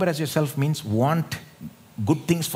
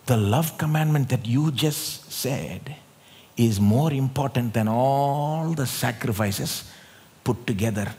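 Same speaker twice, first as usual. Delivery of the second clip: slow, weighty quote; 2 semitones lower, slower than usual. Acted. Slow retelling of the scribe's words.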